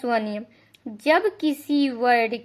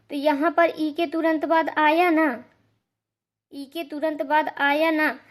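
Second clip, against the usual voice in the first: ordinary talk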